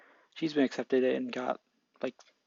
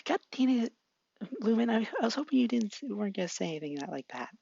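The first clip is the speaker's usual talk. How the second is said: put-on high, flustered girl voice; Jules flustered; 9 semitones higher than usual. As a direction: squeal it out in a panic. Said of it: "and Jules is like".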